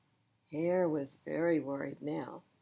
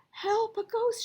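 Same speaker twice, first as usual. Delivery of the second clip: high, loud shriek of fright; terrified scream; 7 dB louder than usual. A narrator's reading. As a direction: scream at the ghost. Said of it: "shouted Frog and Pig and Duck".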